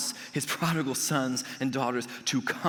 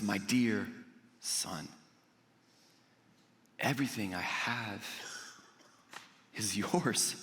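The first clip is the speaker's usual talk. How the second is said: soft, slow loving assurance; tender father; 7 semitones lower, 7 dB quieter than usual. Acted. Father reassuring his older son, soft and tender. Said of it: "it says".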